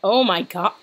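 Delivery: ordinary delivery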